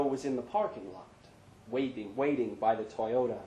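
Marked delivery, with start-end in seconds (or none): none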